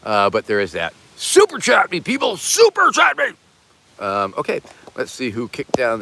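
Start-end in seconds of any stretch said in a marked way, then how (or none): 1.2-3.3 high shouted plea for super chats; hammed-up yell at the viewers; mock demand. Streamer yells at viewers to super chat, high and loud.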